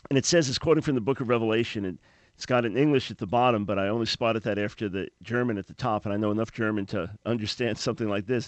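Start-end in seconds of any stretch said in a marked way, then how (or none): none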